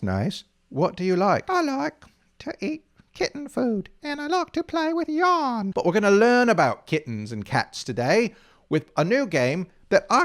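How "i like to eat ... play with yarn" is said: kitten voice. Pretend. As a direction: squeak it out like a tiny kitten. Kitten tells what it likes.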